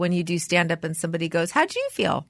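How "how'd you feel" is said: high, soft, evasive query; imitating a careful friend, pitched up and quiet; tactful mock question. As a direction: delicately dodge with a lifted question.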